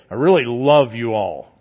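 love spoken with a lifeless drop; sarcastic flat delivery. Shown as a demonstration. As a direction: say "really" dully, meaning none of it. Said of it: "your inflection, your voice".

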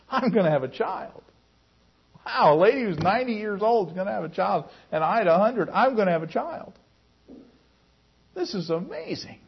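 acted wonder, almost amused; joyful amazement. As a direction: marvel at impossible good news. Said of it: "a laugh more of amazement".

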